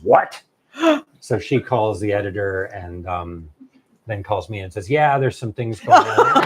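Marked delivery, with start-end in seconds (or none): 0.0-0.4 louder, higher incredulous agent; shocked. Retold or imitated. Agent hearing the author's news, shocked. 4.9-6.4 loud, higher, drawn-out parroted excuse; mock excuse. Agent relaying the editor's vague excuse, mockingly.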